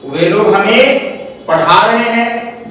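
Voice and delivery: slow, drawn-out example sentence with a pause; slow dictation. Shown as a demonstration. Teacher dictating an example sentence to the class, slow and spaced out. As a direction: dictate it slowly in separate chunks.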